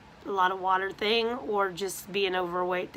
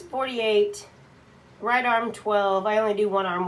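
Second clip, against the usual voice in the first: ordinary delivery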